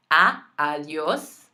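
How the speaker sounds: adios with a pure open A, slow; Spanish vowel demo. Shown as a demonstration. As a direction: stretch the A in adios like ah. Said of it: "what you'll say out loud".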